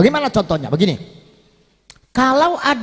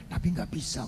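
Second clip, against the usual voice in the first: ordinary talk, nothing marked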